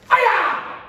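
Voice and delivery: drawn-out high shouted hi-ya; loud kiai. Shown as a demonstration. Instructor finishing the palm strike with a kiai. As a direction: yell it with everything. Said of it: "finish with a loud key up".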